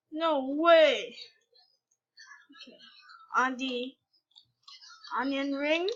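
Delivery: ordinary talk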